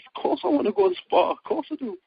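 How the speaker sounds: high, loud eager burst, settling at the end; excited retold reply to his trainer; eager yes. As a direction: gush that of course he wants to go. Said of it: "I goes".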